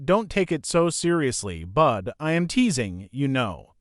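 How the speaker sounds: usual speaking voice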